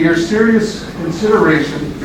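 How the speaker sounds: ordinary talk, nothing marked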